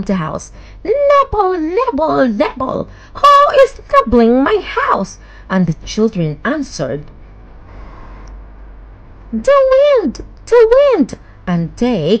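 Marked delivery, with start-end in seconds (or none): 0.8-5.2 put-on high cackling old witch, much higher and louder. A narrator's reading. Witch calling out to the children nibbling her house, high and sly. 9.3-11.2 put-on high, loud childlike cry; children's voices. A narrator's reading. Hansel and Gretel answering the witch, high and childlike.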